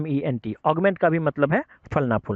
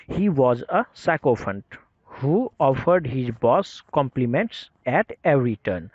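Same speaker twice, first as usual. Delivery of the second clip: slow, careful English reading; slow example sentence; much slower than usual. Shown as a demonstration. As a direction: read the example sentence out slowly. Reads English example sentence slowly. (धीरे धीरे पढ़ा गया उदाहरण वाक्य)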